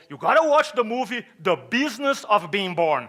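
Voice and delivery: loud, hammed-up recommendation from friends; enthusiastic urging. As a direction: push it loud and high, insistently. Friends urging him, retold.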